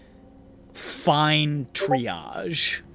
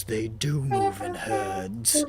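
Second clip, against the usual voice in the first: movie quote voice; 4 semitones lower, 6 dB quieter, much slower than usual. Retold or imitated. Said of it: "and the guy says".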